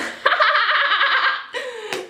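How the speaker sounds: sudden shrieking laugh at her own pun; high giggle. Real, not acted. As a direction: burst into a high-pitched giggle.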